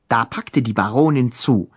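ordinary delivery

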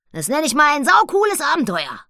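high, loud cheeky pig voice; a thrilled young sow; cheeky piglet. Acted. Annabella the pig speaks. (Annabella, frech, hoch und laut)

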